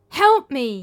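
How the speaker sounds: loud, high cry for help; desperate cry. A narrator's reading. Philip's last plea from the dream, crying out loudly. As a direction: call out one last time in fear. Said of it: "he cried one more time".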